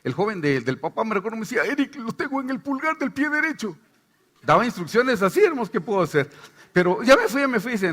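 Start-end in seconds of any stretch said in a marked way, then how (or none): 1.7-3.8 higher voice telling where it hides; a hint of a confiding possessed youth; lightly voiced possessed youth. Possessed youth telling the pastor where it is: higher voice. 7.0-7.7 higher, louder demon insisting; a hint of the lying demon. Lying demon fooling the team again: higher voice.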